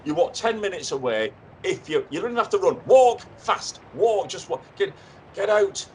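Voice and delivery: urgent hurrying. Acted. Hurrying late guest by phone.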